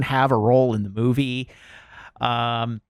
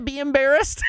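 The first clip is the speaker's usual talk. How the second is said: laughing yell. Real, not acted. Rant peaks into laughing yell.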